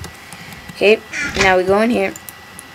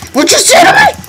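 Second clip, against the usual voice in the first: screamed high indignant challenge; mock outrage; 7 dB louder than usual. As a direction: shriek it like picking a fight. Yelling at a game cow.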